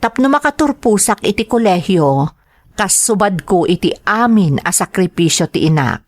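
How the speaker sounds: ordinary talk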